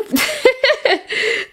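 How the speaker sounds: short high-pitched laugh. Real, not acted. Laughing at the déjà-vu.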